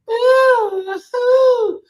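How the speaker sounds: a dramatic high ooh like a diva singer; mock diva ooh. Imitating [name]'s vocal ooh.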